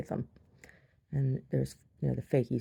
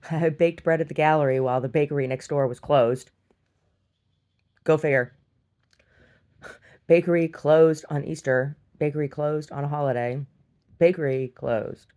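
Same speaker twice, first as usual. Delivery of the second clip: loud, emphatic declaiming; mock proclamation; 7 dB louder than usual. Gallery baker on closed bakery, loud mock outrage. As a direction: declare each line like a headline.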